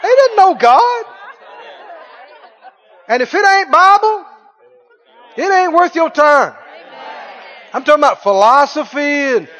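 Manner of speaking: loud, high-pitched preacher's delivery; forceful preaching. Real, not acted. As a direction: drive it home to the congregation at full voice.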